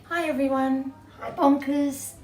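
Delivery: ordinary delivery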